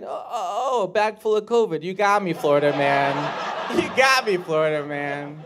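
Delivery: hammed-up cry of being tricked; building mock shout; mock outrage. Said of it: "And I was like".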